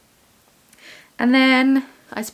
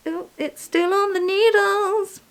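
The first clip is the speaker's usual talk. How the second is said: surprised; 13 semitones higher, slower than usual. Real, not acted. Notices the knitting unfinished.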